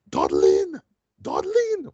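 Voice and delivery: mock film hero calling his wife; hammed-up Will Smith impression; imitated calling out. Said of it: "where he's calling his wife".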